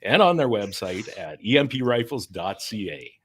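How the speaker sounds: punchy sponsor plug; ad read. Acted. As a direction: pitch the website like an ad.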